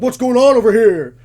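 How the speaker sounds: high, very loud demand; alarmed official yelling. Retold or imitated. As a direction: imitate an official shouting across the campsite. Officials arrive and demand answers.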